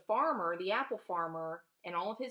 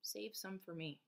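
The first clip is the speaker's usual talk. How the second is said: soft repeat; 11 dB quieter than usual. Retold or imitated. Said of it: "the farmer keep saying".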